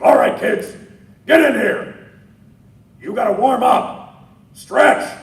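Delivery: loud, high, cocky coach bark; arrogant coach. Shown as a demonstration. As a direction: boss the kids like a cocky coach. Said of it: "If he's got this sense of arrogance to him".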